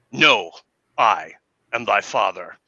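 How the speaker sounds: dark lord's low reveal; deep dramatic reveal. Acted. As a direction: drop the voice for the big revelation. Famous reveal line.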